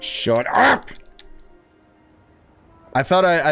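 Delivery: ordinary delivery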